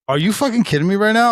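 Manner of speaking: high, loud incredulous outburst; stunned disbelief. Retold or imitated. Friend stunned at the job question, high loud disbelief.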